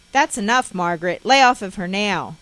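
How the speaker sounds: a father finally shouting enough; stern loud command. Retold or imitated. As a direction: bark it loud to stop the fight. Father stepping in to stop mother.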